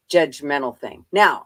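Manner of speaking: ordinary talk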